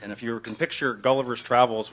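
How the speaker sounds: usual speaking voice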